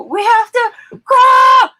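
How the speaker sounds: high, loud, slow crying shout; mock shout. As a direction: yell it like the film scene. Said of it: "hearing myself say that over and over".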